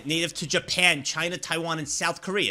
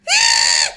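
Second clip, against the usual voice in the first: scream; 14 dB louder than usual. Retold or imitated. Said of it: "she goes".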